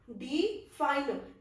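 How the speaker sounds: Define very slow, unstressed डि then stressed fine; define split weak-strong with a pause; stress on second syllable. Shown as a demonstration. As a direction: draw out define, leaning on fine. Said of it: "fine में हमारा stress".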